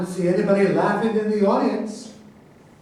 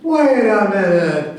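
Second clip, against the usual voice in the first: indignant interruption; 7 dB louder, slower than usual. Acted. Heated debate, president interrupts.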